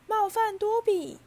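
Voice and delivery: very high, loud, drawn-out elf voice; choked-up wail. A narrator's reading. House-elf answering Harry's apology, overcome and wailing. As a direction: wail it as an overwhelmed creature holding back sobs. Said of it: "小精灵强忍住呜音说".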